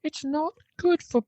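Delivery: high, hammed-up British pub voice; mock British accent. Cohost reads the bartender's quote, in a hammed-up British accent. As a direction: read it as a mock British barman.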